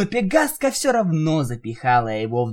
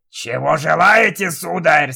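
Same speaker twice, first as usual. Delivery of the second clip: Jigurda voice; 2 semitones higher, 5 dB louder than usual. Acted. Voiced magic head servant.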